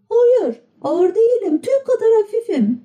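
high, quick and keen; eager puppet boy. A narrator's reading. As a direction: jump at the chance. Pinokyo eager to fly.

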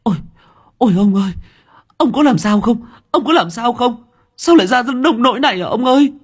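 high, loud panicked wail; shrieking wail. Acted. Wife finds husband dead on the path, shrieking wail. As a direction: wail over him in terror. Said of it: "tiếng của bà Được rú lên".

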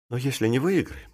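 hushed, anxious question; trembling fear. A narrator's reading. Nervous clerk asking his partner about losing, fearful. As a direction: ask it softly, afraid of the answer. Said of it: "с трепетом спросил Маковой".

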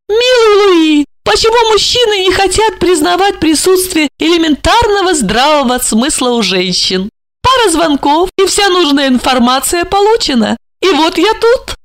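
высокий голос Ольги, игриво; поддразнить любимого; шутливо. Acted. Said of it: "шутливо спросила". (Ольга шутливо дразнит Жарона)

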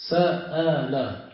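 slow, drawn-out سأل with each syllable clear. Shown as a demonstration. Teacher spelling out a verb pattern for students, slow. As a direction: stretch the word سأل slowly for the class. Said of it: "shows the past-tense verb form".

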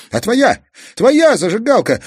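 put-on high, loud boyish cry of realization; sudden shout. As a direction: shout out the idea. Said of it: "вдруг закричал Казик". (внезапный крик)

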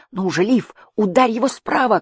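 high urgent yell; shouting. A narrator's reading. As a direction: shout the order across the fight. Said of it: "кричал мальчик".

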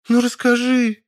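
high, drawn-out spoiled whine; high-pitched pouty pleading; capricious. A narrator's reading. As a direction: wheedle petulantly, stretching it out. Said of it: "Капризным голосом протянула она".

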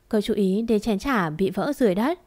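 ordinary delivery, nothing marked

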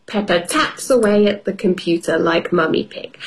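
ordinary delivery, nothing marked

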